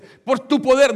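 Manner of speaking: "Por tu poder" called out high and loud; wrong version. Shown as a demonstration. Preacher voicing the mistaken prayer, "Por tu poder" loud and high. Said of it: "Y uno dice".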